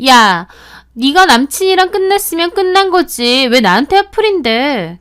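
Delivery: loud, high furious yell; angry yell. Acted. Friend blowing up at narrator over the breakup, furious yell. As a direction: blow up back at her. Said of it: "본인이 더 화를 내더라고요".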